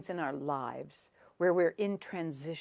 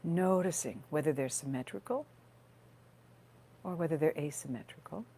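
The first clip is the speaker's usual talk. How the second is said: guided meditation. Real, not acted. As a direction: gently guide listeners into their breath, leaving space between phrases.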